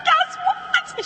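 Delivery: very high teasing squeal; bursting with gossip. Shown as a demonstration. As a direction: dangle the news excitedly. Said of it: "sound like you've got gossip".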